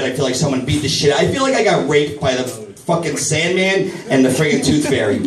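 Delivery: ordinary talk, nothing marked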